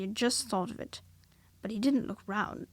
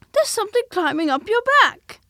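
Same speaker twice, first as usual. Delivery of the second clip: much higher, louder excited squeak; excited Piglet; 11 semitones higher, 13 dB louder than usual. A narrator's reading. Piglet warning Pooh, high loud excited squeak. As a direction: blurt it out in a rush.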